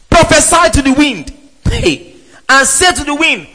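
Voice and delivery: preached command. Shown as a demonstration. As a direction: proclaim Prophesy to the wind like an order.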